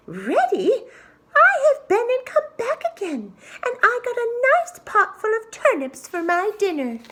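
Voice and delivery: very high, squeaky gloating pig; smug little pig. Acted. Little pig boasting to the wolf about the turnips, high and squeaky. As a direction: crow over the tricked wolf in a tiny voice.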